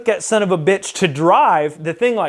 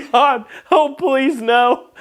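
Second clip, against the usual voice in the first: high mock plea, amused underneath; pleading dread; 8 semitones higher, much slower than usual. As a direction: beg in a raised pitch, close to laughing. Retelling dread at reporting it.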